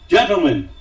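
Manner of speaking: loud, sharp opening word; sharp piercing call. A narrator's reading. Satan addresses the heavenly court, sharp and loud. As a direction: cut through the courtroom with it. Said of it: "he said in a sharp, piercing voice".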